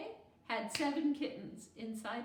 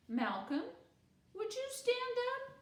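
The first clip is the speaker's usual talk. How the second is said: teacherly invitation. A narrator's reading. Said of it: "Malcolm rose from his seat".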